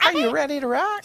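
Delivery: high squeaky cartoon call; vintage cartoon voice. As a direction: bounce it out like a cartoon hero. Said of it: "like, a cartoon voice".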